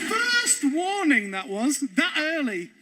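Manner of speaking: ordinary talk, nothing marked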